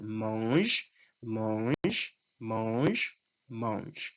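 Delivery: mange four times low, each the same, ending on the J sound; repeated French verb form. Shown as a demonstration. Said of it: "é a mesma coisa".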